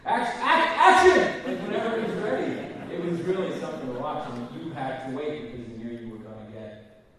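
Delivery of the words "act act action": building, much higher and louder call; director calling action. Retold or imitated. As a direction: stammer out "act" and explode into action. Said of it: "And Steven's like".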